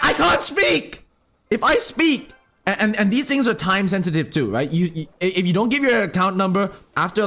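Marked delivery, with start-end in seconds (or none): 0.0-2.3 high-pitched, drawn-out protest; acted frustrated outburst; exasperated retort. Exasperated retort to his sister.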